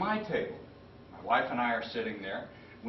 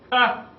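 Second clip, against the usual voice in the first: amused bark of a laugh; 12 semitones higher, 10 dB louder than usual. Retold or imitated. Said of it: "He looks at me and goes".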